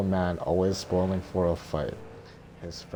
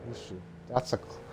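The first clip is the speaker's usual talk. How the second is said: plain reading voice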